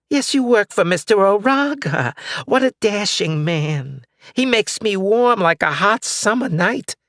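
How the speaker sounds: put-on high, dreamy old lady; swooning old woman. Old neighbor swooning over the boss, high and dreamy. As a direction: gush like a smitten old neighbor.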